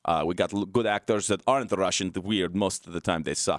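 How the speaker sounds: put-on light comic Russian accent, pitching a show; mock Russian accent. Host pitching his comedy series in mock Russian accent. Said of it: "the series I wrote in Russian accent".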